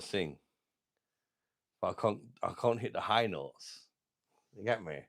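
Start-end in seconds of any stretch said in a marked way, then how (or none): none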